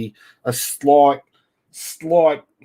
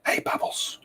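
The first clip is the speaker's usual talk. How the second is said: coaxing whisper; 9 dB quieter than usual. Pretend.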